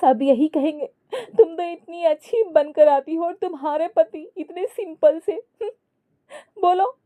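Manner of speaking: high, whiny mock tearful voice; wife whining tearfully to her husband; crying. A narrator's reading. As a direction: complain through hammed-up tears, pitched high. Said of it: "जूही रोते हुए बोली".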